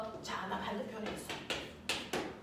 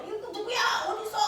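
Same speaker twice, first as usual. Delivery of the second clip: mock yell; 9 semitones higher, much faster than usual. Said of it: "소리를 지르면".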